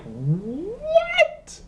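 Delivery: high, drawn-out shocked what; disbelieving squeak of surprise; stunned what. Retold or imitated. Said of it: "I'm like".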